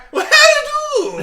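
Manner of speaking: very high, loud cartoon leprechaun. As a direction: squeal it like a leprechaun. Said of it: "a leprechaun on the front going like".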